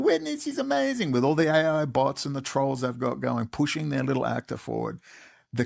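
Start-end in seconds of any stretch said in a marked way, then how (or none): 0.0-1.1 high, sing-out mock gushing; mock-adoring high squeal of praise; mocking fans. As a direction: mimic gushing fans in a much higher voice. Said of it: "and you're all going".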